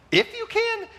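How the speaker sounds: a sudden very high, indignant repeat; incredulous squeal. Retold or imitated. Jesus echoing the father, high and offended. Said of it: "Jesus kind of responds with an attitude".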